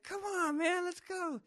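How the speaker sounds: very high, loud urging yell; high-pitched, impatient shout; calling out. Retold or imitated. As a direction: holler to hurry a friend along. Acted yelling at fallen friend.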